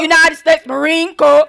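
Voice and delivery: barked marching cadence, very loud; drill sergeant cadence. Acted. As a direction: shout the count like a drill instructor. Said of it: "do a little cadence".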